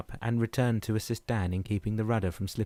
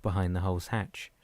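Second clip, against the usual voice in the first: ordinary delivery, nothing marked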